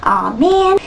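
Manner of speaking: put-on moany protest; attitude. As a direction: grumble like a reluctant worker. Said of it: "they have a bit of an attitude".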